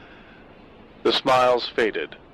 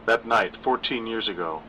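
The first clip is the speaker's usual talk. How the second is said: usual speaking voice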